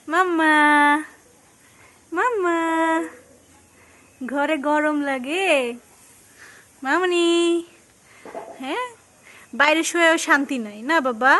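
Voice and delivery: put-on lilting sing-song questions to a baby; baby talk. Mother asking her baby where she lies, in sing-song baby talk. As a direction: coo at the little one, drawing out the questions.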